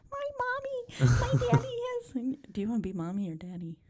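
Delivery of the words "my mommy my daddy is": put-on high, slow little kid voice; squeaky small child talking. Imitating a confused child.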